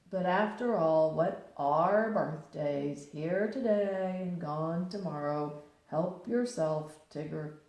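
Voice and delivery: slow, mournful donkey, dragging each word; gloomy Eeyore. A narrator's reading. Eeyore greets Pooh and Tigger, slow gloomy donkey voice. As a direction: sigh the words out like an old grey donkey. Eeyore offers his thistles.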